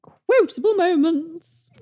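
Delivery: slow, high title with a fake echo; echo effect. Host adding a mock echo to the segment title. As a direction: voice the echo of the jingle. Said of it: "I created a sodding effects for that".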